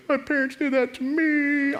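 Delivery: high, whiny pretend sob; hammed-up hurt little whimper; mock crying. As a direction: wail it in a put-on tearful voice. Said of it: "Someone in here is crying inside".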